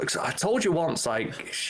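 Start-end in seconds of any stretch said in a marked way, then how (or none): none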